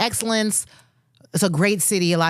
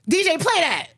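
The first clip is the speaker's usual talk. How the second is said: hype crowd voice; 11 semitones higher than usual. Retold or imitated. Mimicking hype crowd at show.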